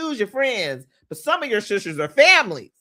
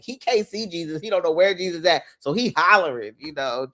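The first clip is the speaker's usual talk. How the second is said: ordinary talk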